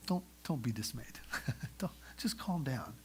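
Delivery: calm them down gently; soothing hush. Retold or imitated. Joseph calming his terrified brothers, softly hushing.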